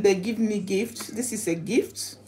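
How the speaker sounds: ordinary talk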